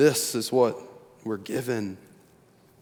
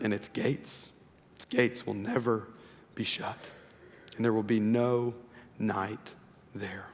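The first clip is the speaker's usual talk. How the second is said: slow, soft scripture reading; hushed reverent reading; 4 dB quieter than usual. Acted. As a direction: read the promise gently and reverently. Reads scripture passage slowly.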